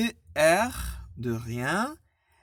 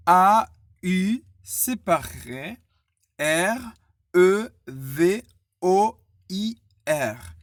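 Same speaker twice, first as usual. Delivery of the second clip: slow, loud letters with gaps; spelled letter by letter; 6 dB louder, slower than usual. Shown as a demonstration. Teacher spelling au revoir for learners, letter by letter. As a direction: call out every letter distinctly. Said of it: "dire lettre par lettre".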